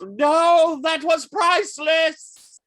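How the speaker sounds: high, loud, drawn-out cry of protest; villain's wail of outrage; outraged villain. Acted. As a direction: shout it in anguish at the loss. Villain reacts to device being smashed.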